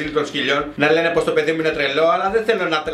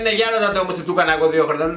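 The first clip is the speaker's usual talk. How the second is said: rushed quote; 2 semitones lower, much faster than usual. Retold or imitated. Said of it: "Και λέει".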